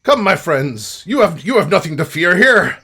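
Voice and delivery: friendly rogue, welcoming. Acted. Hooded rescuer reassures adventurers, hearty.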